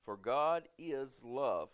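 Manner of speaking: measured pulpit reading of scripture. Acted. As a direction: read the Bible passage with weight. Reads scripture aloud to congregation.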